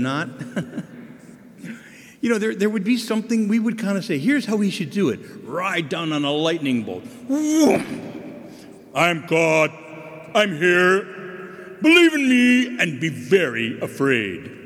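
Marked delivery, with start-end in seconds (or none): 4.2-7.0 booming mock God voice; hammy stage actor. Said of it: "Pardon my theatrics". 7.0-8.5 high whooshing vroom; mock lightning whoosh. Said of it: "Pardon my theatrics". 8.9-14.6 booming mock God voice; hammy stage actor. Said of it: "Pardon my theatrics".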